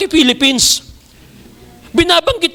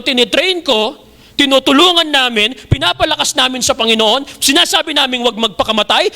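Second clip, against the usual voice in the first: ordinary delivery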